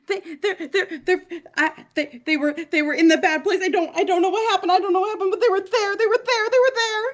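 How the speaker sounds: high, loud, tearful panicked babble; full hysterics. Acted. Liv panicking to the soldier, in full hysterics. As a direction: stammer it out in a terrified rush, near sobbing. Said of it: "full hysterics".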